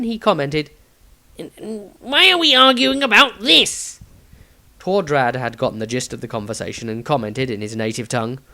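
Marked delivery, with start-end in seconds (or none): 1.4-4.0 high stammer; stammering Tobias. Acted. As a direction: stutter then ask, flustered.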